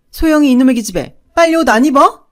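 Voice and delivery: fast, high, loud scolding; angry yell. A narrator's reading. Mother scolding the little sister for not dressing, angry yell. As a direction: snap at the dawdling child, loud and quick. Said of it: "화를 냈어요".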